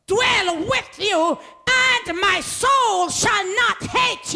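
high, loud, slow proclaiming voice; booming divine declaration; voice of God preached. Acted. Voicing God, shouted slow.

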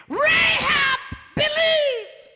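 high, loud, drawn-out cry; shouted proclamation. Real, not acted. Preacher holding up Rahab as an example of belief, drawn-out shout. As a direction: stretch both words in a pulpit shout.

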